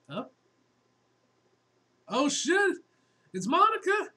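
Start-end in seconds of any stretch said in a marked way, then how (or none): 2.1-4.1 high, loud startled cry; excited shout. Acted. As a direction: yell it out in shock. Character spots Monica, cries out.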